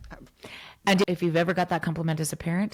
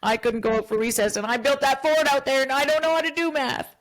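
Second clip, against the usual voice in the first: put-on upset kid voice; 5 semitones higher than usual. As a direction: voice the frustrated kid. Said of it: "he might say, like".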